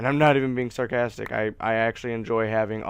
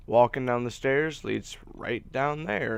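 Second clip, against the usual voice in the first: normal talking voice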